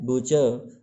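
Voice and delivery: बुचर pronounced "BUH-chuh"; silent final r. Shown as a demonstration. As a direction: leave the र off the end of बुचर. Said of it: "तो बोलना है". (बुचर को बिना र के बोलकर)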